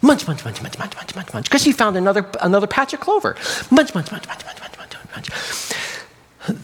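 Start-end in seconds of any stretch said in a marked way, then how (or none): 0.0-1.5 quick, soft munch-munch chomping; munching noises. A narrator's reading. Lamb happily eating clover, soft rapid munching. 3.7-6.6 munching that fades into a whisper; munching noises. A narrator's reading. Lamb lost in another clover patch, whispered munching. Storyteller mimics lamb eating.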